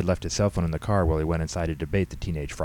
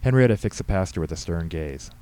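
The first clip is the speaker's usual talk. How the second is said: ordinary delivery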